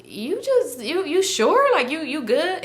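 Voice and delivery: high, hesitant imitation of worry; concerned. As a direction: imitate a worried friend checking in. Girl checks on sick narrator.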